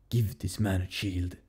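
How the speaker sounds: put-on low, soft, slow stab at T'Challa's Wakandan accent; attempted T'Challa accent. Fan imitating a movie king's line, attempted Wakandan accent. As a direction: try a measured Wakandan accent, quietly. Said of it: "I can't even do it. The accent".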